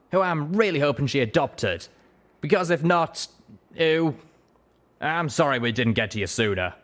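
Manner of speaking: brisk, businesslike griffin; griffin captain. A narrator's reading. Griffin captain explains her Talons came to help, businesslike. Blackwing talks of Butcher.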